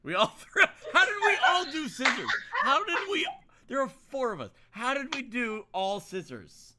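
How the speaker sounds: laughing, high and loud; laughing disbelief. Real, not acted. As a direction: crack up in disbelief. Everyone threw scissors.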